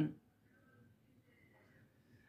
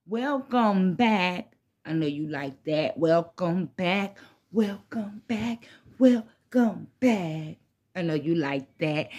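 stoner; 6 semitones lower, 3 dB quieter, slower than usual. Real, not acted. Opening a smoke session vlog.

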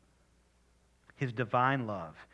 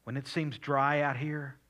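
whispered prayer. Acted. Quiet personal prayer.

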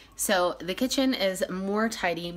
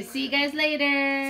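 sing-song prompt; 8 semitones higher, 4 dB louder than usual. Shown as a demonstration. Mother prompting the kids to sign off, high sing-song. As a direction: model a cheery sign-off for a child to copy.

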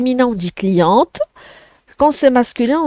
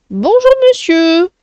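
higher, lightly voiced lady customer greeting; teacher voicing the customer's greeting, higher; 8 semitones higher than usual. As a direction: play the customer saying hello, pitched up. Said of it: "à toi de retenir ces deux premières répliques".

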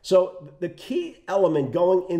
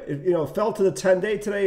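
ordinary talk, nothing marked

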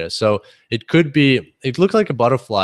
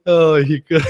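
words through laughter, higher; laughing; 5 semitones higher, 5 dB louder than usual. Real, not acted. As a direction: say it while laughing.

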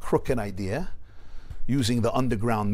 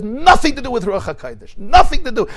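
emphatic denial; 12 semitones higher, 11 dB louder than usual. Retold or imitated. Rebbe firmly denies prophecy.

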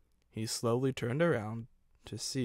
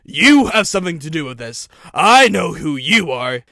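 loud, raised, forceful charge; angry accusation; 4 semitones higher, 15 dB louder than usual. A narrator's reading. Stephen rounding on the owl, loud and angry. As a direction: hurl the accusation at full force.

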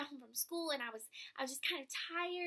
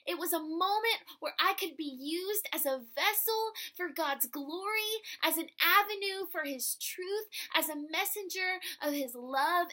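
louder, swelling proclamation; impassioned preaching; 4 semitones higher, 8 dB louder than usual. Real, not acted. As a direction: proclaim it with full fervour. Preaching climax.

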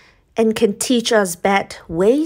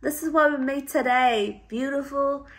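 excited; 6 semitones higher, 3 dB louder than usual. Real, not acted. Showing off the candle.

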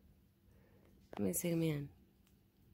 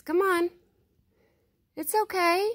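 higher, louder sing-song call to an animal; coaxing pet call; 8 semitones higher, 6 dB louder than usual. Real, not acted. Vlogger calling Mama and hushing the cats, coaxing loud call. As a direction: coax the shy animal closer, calling out.

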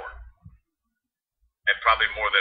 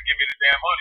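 ordinary talk

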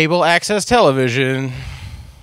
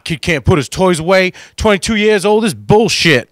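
dad's rant; 5 semitones higher, 5 dB louder than usual. Retold or imitated. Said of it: "He was like".

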